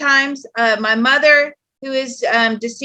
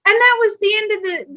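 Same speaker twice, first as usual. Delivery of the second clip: ordinary talk, nothing marked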